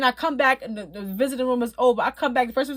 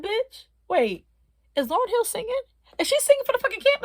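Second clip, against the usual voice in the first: high, fast incredulous outburst; can't believe what she's hearing; shocked disbelief; 11 semitones higher than usual. Retold or imitated. Stunned to hear [name] singing for the camp, high and fast.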